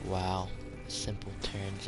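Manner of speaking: ordinary talk, nothing marked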